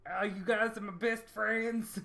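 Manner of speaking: pompous doppelganger Lucien. Pretend. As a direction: announce yourself like a grandiose fraud. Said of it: "he talks like this".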